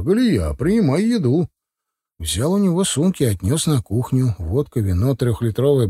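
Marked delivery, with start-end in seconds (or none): 0.0-1.5 higher-pitched, hearty call; animated announcement, pitch climbing; exclaiming. A narrator's reading. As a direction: call out cheerfully to the hostess. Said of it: "воскликнул Пётр".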